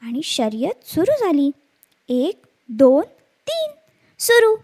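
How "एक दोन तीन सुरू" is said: loud, high starter's count; race countdown. Acted. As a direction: call out the start of a race, rising sharply. (शर्यत सुरू करण्याची मोठ्या आवाजातली हाक)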